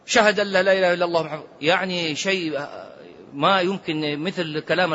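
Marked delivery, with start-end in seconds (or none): 0.1-1.4 fast, clipped-together شهد أن لا إله إلا الله محمد; rushed. Retold or imitated. Sheikh mimicking hasty people, fast mock gabble. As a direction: gabble the shahada fast, dropping the أ of أشهد. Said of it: "بعض الناس يعجل يقول".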